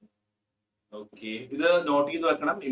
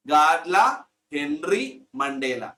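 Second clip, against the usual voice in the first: slow name model; much slower than usual. Shown as a demonstration. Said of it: "teacher modelling name".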